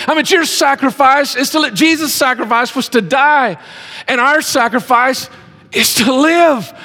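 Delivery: rising revival preaching; fervent preaching. Acted. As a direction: proclaim it to the congregation. Preaching builds to full force.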